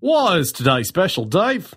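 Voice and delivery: high, playful echo of the question. Acted. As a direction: toss the question back brightly. Echoes cue playfully.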